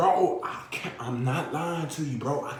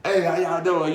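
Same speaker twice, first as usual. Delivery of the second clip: higher, louder cheerful hello; playing a cheery worker walking in; happy greeting; 4 semitones higher, 8 dB louder than usual. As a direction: greet the whole room brightly. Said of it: "all happy and shit".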